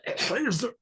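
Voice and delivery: high, scornful mock disbelief; scoffing. Police chief scoffing at the rabbi at his bedside. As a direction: sneer at the idea the rabbi could help. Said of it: "he's scoffing".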